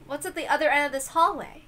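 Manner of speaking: ordinary delivery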